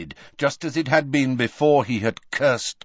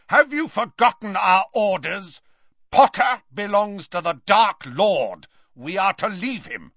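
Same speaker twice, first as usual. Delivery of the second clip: raised, higher voice of command; Snape; 6 semitones higher, 3 dB louder than usual. A narrator's reading. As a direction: shout a rebuke at disobedient followers. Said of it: "Snape was shouting".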